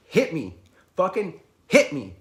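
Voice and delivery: loud, high goading punches of words; forceful retold taunt; shouted dare. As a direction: dare him to swing.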